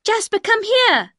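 high, loud, drawn-out command; slow, high-pitched yell to a dog; shouting. Acted. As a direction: shout the dog back to you. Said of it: "I shouted".

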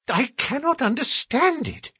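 high-pitched, baffled gentleman; ill at ease. A narrator's reading. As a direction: fret in a high, uneasy voice. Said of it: "seemed ill at ease".

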